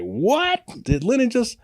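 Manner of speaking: high, astonished gasp of a question; shocked disbelief. Retold or imitated. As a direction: react as if you can't believe it. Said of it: "the Mexicans was like".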